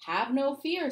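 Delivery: high, loud heroic call; heroic declaration. A narrator's reading. Mister Cooper answering the fire as a hero, loud heroic call. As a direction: announce it like a superhero. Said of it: "This is a job for Cooperman".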